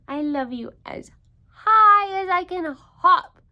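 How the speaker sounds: loud, high bursting cry; big eager hare voice; excited little hare. Acted. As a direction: shout it up to the treetops. Little hare shouts his love.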